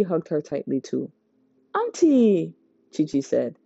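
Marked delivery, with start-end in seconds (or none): 1.7-2.5 happy greeting. A narrator's reading. Chi-Chi greets aunt joyfully.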